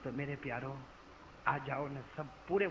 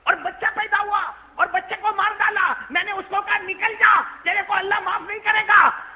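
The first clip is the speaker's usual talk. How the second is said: much higher, louder indignant shouting; angry outburst; 12 semitones higher, 9 dB louder than usual. Acted. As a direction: still furious as he reports it. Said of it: "Abu Huraira गुस्से में कहने लगे". (ग़ुस्से में ऊँची आवाज़ में बोलना)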